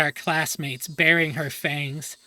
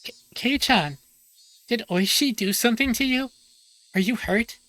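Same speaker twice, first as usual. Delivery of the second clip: put-on higher, anxious girl rushing in; worried Mion; 6 semitones higher, 3 dB louder than usual. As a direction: fret over him, breathless and high. Mion voiced, worried about Keiichi.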